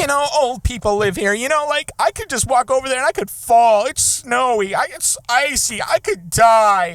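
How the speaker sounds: high whiny busybody listing dangers; high mock neighbor voice. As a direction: mimic a fussy neighbor, dragging out the last line. Mimicking the neighbor's list.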